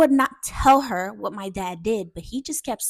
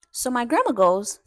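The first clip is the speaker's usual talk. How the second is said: usual speaking voice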